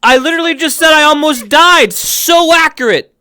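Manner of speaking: high, loud laughing outcry; excited laughing. Real, not acted. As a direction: crack up and shout it. Laughing at the clip.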